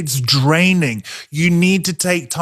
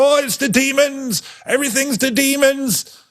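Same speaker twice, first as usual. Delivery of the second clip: much higher, mocking caricature; 8 semitones higher than usual. Preacher parodying hyper-charismatic Christians, high mocking voice. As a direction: send up the over-the-top believer. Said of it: "we go".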